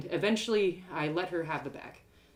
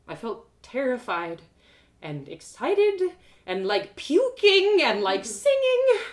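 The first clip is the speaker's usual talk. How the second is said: slow, rising higher and louder into singing; building excitement; 6 semitones higher, 8 dB louder, slower than usual. A narrator's reading. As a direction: let the feeling swell from scared to joyful. Narrator's emotions building up.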